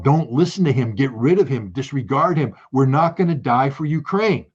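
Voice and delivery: a defiant crowd calling out, pitch up. Pretend. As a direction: call it out like a protest. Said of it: "need to shout down".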